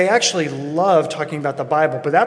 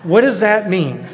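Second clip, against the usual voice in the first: taken aback; 3 semitones higher, 5 dB louder than usual. Retold or imitated. Caught off guard by friend's remark.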